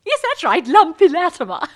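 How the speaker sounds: loud, high, hammed-up delight; laugh, then hoot the old nickname; jolly reunion whoop; hearty recognition. Owning old nickname again at reunion.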